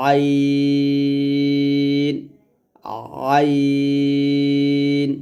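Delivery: আইন drawn out with light pressure from mid-throat; Arabic letter demo. Shown as a demonstration. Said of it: "মাঝখান থেকে চাপ দিয়ে বলেন". (গলার মাঝখান থেকে চাপ দিয়ে আইন)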